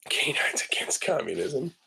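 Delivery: breathy whisper of the slogan. Real, not acted. Reading a shirt slogan out as a whisper. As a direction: hush it like a secret motto.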